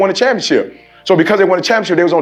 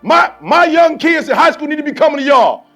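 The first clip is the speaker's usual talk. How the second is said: rising, louder sermon cadence, hammering each line; loud, driving call to set the standard; preaching at full force; 12 semitones higher, 3 dB louder than usual. Acted. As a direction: fire up the team like a preacher in full flow. Fired-up speech to the team.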